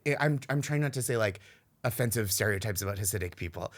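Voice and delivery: normal talking voice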